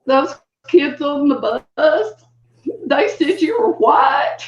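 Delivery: put-on high, slow, tearful child's voice; student crying to his teacher, high and slow; imitating a crying boy. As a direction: act out a small boy's sobbing complaint. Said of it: "Jerry, was crying".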